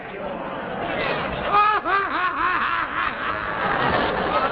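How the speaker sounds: loud, high forced guffaw; long sarcastic "ha ha ha" laugh; mock laugh. Husband fakes amusement at wife, mocking laugh. Fake laugh at wife's joke.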